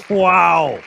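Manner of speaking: amazed loud wow. Real, not acted. Host reacts to Maddie's win, amazed and loud. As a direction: blurt out the amazement.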